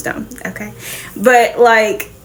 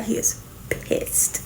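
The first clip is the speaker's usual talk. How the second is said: whispered aside; 12 dB quieter, slower than usual. Real, not acted. Aside about Blade's anger.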